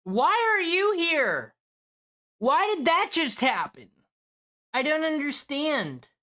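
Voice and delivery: high, hammed-up dismay; mock bewildered wail. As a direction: wail it in exaggerated disbelief. Mock dismay at Nick's return.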